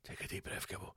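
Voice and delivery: barely voiced whisper; whispered self-calming. Real, not acted. Angry ranter tells himself to breathe, whispered self-calming. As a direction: whisper it to yourself to cool down. I'm getting angry, Gibbo.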